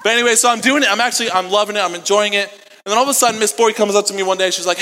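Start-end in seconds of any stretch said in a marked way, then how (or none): none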